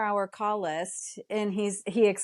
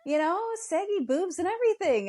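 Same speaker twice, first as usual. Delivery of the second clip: mock outrage; 9 semitones higher than usual. Mock outrage at ungrateful babies.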